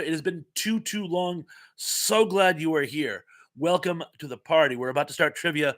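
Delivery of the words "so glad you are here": ordinary delivery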